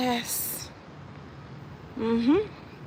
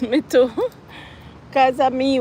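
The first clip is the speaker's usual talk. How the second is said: usual speaking voice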